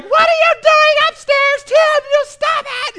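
high-pitched, loud scolding yell; mock screech of outrage; yelling wife. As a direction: imitate an angry wife hollering upstairs. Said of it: "then Rebecca's yelling".

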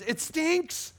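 high, slow, drawn-out disgust; mock the roommate's outrage. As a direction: roommate catching him cooking Spam, high disgusted voice. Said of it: "he just says".

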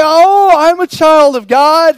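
mock a pious boaster; mocking smug churchgoers, drawn out. Mocks self-satisfied believers.

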